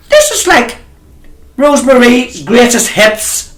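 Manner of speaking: high, slowed teasing exclamation; mock indignant exclaim. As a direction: tease him with a high mock-outraged cry. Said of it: "I says".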